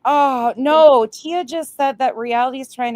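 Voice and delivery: high loud oh no; shocked. Real, not acted. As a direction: cry out in shocked disbelief. Reacting to chat, shocked.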